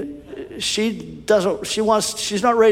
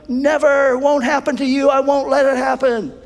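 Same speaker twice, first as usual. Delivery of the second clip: much higher, louder never, won't happen; Peter's alarmed protest; 11 semitones higher, 4 dB louder than usual. Acted. Peter rejecting Jesus's prediction, high loud protest. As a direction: cry out to stop it.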